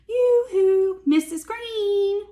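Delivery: high, loud sing-song call; hammed-up princess yoo-hoo. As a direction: call across the garden. Princess calls out.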